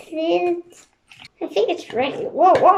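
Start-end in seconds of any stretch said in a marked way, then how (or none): none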